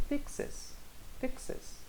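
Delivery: whispered "Fixes"; alternative pronunciation. Shown as a demonstration. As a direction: try the word out under your breath, softly. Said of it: "E que tal assim?".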